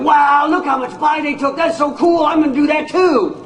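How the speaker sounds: high, gushing fake admiration for the greedy takers; mock admiration. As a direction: play a naive fan who wants to copy them. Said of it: "You wouldn't all be going".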